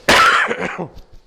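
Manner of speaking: loud harsh cough; coughing fit. Real, not acted. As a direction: clear the throat with a hard cough. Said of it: "I've got a cough".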